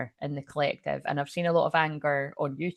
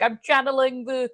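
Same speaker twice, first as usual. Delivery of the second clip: high, put-on mystic voice; mock channeler voice; 5 semitones higher than usual. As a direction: send up a fake spiritual channeler. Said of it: "people that are like".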